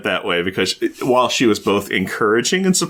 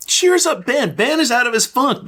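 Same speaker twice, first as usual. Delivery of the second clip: cheering "Cheers up" higher; more excited; 10 semitones higher than usual. Real, not acted. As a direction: hype it up more. Excited recap of comic.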